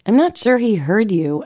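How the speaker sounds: normal talking voice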